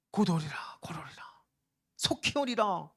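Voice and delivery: unvoiced whisper, then voiced again on the last words; whispered promise. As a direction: breathe the promise like a secret. 주님의 약속을 속삭이듯 인용. (주님의 약속을 속삭이다가 마지막에 소리 내어)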